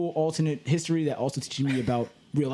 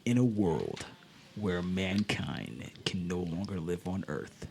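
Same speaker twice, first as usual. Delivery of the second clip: lower, slow movie trailer voice; deep epic trailer narration over music; old sci-fi announcer; 6 semitones lower, 6 dB quieter, slower than usual. Acted. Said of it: "I'm about to go into another fake movie".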